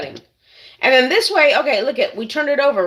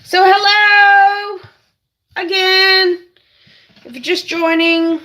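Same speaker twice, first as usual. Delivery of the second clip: high, loud, drawn-out hello; hello stretched long and bright; cheery greeting; 8 semitones higher, 7 dB louder, slower than usual. Real, not acted. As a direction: sing out a slow, big welcome. Greeting new viewers.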